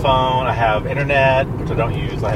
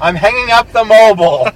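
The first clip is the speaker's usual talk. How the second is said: put-on high, loud old lady on the phone; 6 semitones higher, 6 dB louder than usual. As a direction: ham up the fussy old lady. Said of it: "'Cause I'm an old lady".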